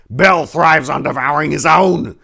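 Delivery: loud, high defiant cry; defiant shout. Acted. Daniel defying the high priest with a loud shout. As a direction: hurl it back in defiance. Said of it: "Daniel shouted in defiance".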